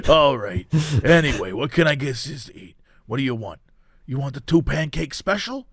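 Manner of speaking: soft, low diner waitress whisper; whispered asmr role play. Pretend. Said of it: "but it's like a diner".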